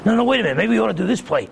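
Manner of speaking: flustered coach dithering, voice jumping up on "No, no, wait a minute"; imitating a panicking coach losing his nerve; panicky coach. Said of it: "John Madden's freaking out".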